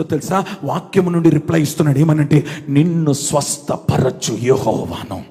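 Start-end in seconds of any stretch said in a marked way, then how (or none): 2.7-5.2 very low, slow, solemn pronouncement; voice of God. Acted. God answering the sick believer through scripture, deep solemn voice. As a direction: intone it deep and unhurried. God's reply quoted from scripture.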